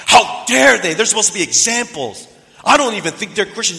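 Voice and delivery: high, loud outraged outburst, imitated; angry, judgmental. Simon's outburst at the dating couple, angry and judgmental. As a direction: explode in bitter indignation. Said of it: "He came out angry and bitter and judgmental".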